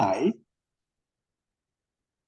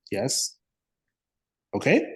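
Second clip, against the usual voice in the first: ordinary talk, nothing marked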